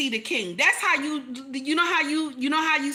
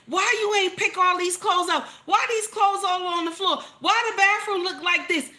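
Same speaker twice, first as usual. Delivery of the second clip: higher, louder nagging at the husband; nagging complaint; 7 semitones higher, 3 dB louder than usual. Acted. Wife scolding husband about clothes, nagging.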